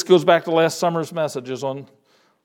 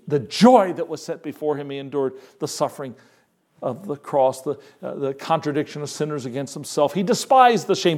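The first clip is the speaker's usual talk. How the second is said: normal talking voice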